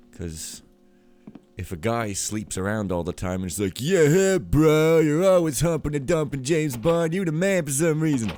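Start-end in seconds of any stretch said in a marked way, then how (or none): 3.8-8.3 loud, high mock hype; cheering bro. Guy hyping his friend who sleeps around, mock bro cheer. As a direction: whoop it up like an admiring buddy.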